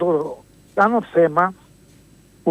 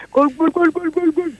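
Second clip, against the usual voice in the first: imitating goal call; 8 semitones higher than usual. Imitating the Argentine commentator's high goal call. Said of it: "ο speaker που έλεγε".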